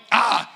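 high, loud "ah" of alarm; short yelp of disbelief, played for laughs; exaggerated astonished exclamation; mock astonished cry. Reacting to the boy's strength.

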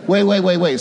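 ordinary talk

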